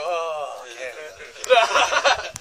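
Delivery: slow, drawn-out mock "oh"; underwhelmed reaction. Guide mimics how most people react to Canada, slow and underwhelmed. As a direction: drag it out as an unimpressed visitor would. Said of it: "most people just go".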